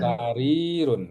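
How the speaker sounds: Sarirun with ri held long and slow. Shown as a demonstration. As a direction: stretch the ri in Sarirun. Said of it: "rinya panjang".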